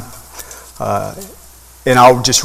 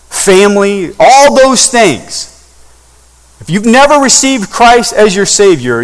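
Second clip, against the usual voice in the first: slow, builds higher and louder to a peak; building preaching; 6 semitones higher, 4 dB louder, slower than usual. Acted. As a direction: press each item harder until it rings out.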